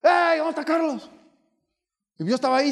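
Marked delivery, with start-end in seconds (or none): 0.0-1.1 mock angry pastor. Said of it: "echando pestes".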